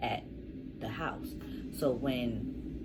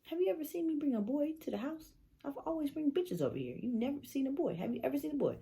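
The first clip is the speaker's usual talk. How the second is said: high, quick insistent questioning; pitched-up indignant protest; retold exasperated arguing; faster than usual. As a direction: argue the obvious in disbelief. Retold argument with mom.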